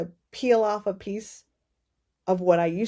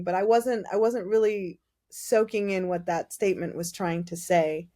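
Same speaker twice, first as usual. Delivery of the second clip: ordinary talk, nothing marked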